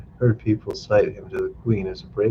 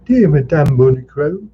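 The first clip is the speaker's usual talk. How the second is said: man calling through ceiling; 8 semitones higher, 6 dB louder than usual. Acted. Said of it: "A man was saying".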